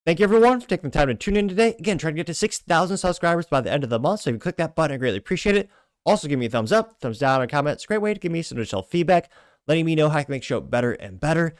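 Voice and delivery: rally the viewers like a host wrapping up; upbeat outro. Acted. Energetic channel outro appeal.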